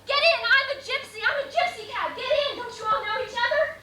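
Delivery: shouting high and loud at people across the street; retold yelling. Man retelling how he yelled at celebrities in the street, loud high shout. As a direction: yell like a cabbie hollering for fares. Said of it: "and I yell".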